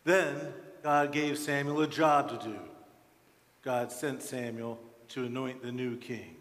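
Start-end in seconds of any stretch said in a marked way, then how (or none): none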